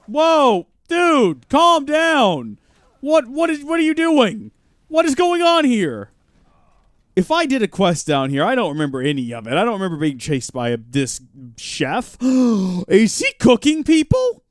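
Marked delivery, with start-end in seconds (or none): 0.1-6.1 higher and louder, startled and rattled; shocked by what he sees; alarmed surprise. Real, not acted. Player startled by the attacking chef, alarmed shout. 12.2-14.4 gasping, higher, louder and slow; a sudden horrified realisation. Real, not acted. Player realising the chef cooks people, horrified gasp.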